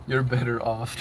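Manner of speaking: normal talking voice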